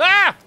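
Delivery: sudden loud high yelp; startled scream. Real, not acted. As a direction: jump at the attack with a yell. Startled by enemy in game.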